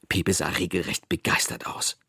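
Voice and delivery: ordinary delivery, nothing marked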